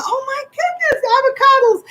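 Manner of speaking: re-enacted high-pitched thrill; excited exclamation. Shopper retelling her find of cheap avocados at the store, excited. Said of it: "I was excited".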